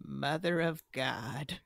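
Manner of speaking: slow, soft, stunned hush; awed hush. Acted. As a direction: whisper it in awe of the horde. Awed at the horde.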